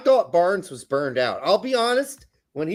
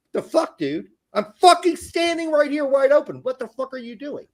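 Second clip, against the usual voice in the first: mock furious rant at a teammate, louder in the middle; angry teammate rant; 6 semitones higher, 3 dB louder than usual. Striker scolding a teammate after the ball went out, mock angry rant. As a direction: play the open striker yelling at his teammate. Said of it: "looked at that motherfucker ... and went".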